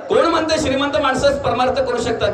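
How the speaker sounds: ordinary delivery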